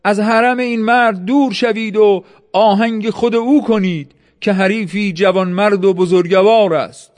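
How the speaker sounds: high, projected narrated command; shouted order. A narrator's reading. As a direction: call the order out to the troops. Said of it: "فریاد زد".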